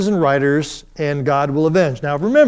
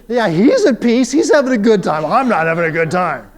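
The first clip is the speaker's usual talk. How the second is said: mock inner complaint; 3 semitones higher, 5 dB louder than usual. I'm thinking.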